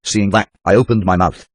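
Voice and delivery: plain reading voice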